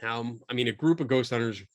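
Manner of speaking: normal talking voice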